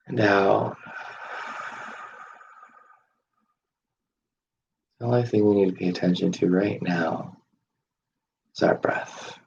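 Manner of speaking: soft breath-led meditation guidance with long pauses; guided breathing. Shown as a demonstration.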